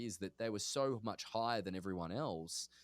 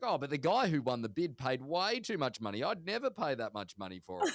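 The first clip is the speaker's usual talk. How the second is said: mock-jovial dad, higher and louder; happy, jovial; 5 semitones higher, 5 dB louder than usual. Happy dad on speakerphone telling his child about the auction, jovial. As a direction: imitate a cheerful dad, voice lifted and punchy. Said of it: "Dad's really happy and jovial".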